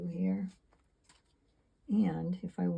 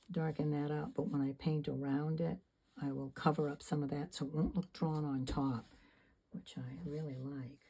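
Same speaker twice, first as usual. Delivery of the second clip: normal talking voice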